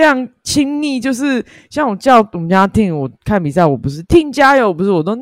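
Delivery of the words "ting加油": cheering. Retold or imitated. Cheering husband on during match.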